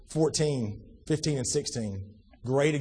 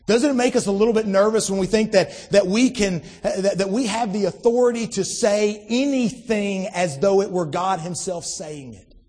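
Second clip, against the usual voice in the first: rising preacher's plea, louder each time; preaching; 5 semitones higher, 5 dB louder than usual. Real, not acted. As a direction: press the question on the congregation. Preaching builds on repeated question.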